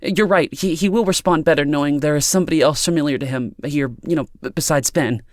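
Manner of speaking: high, eager, stumbling gratitude; relieved. A narrator's reading. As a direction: thank her in a rush of nervous relief.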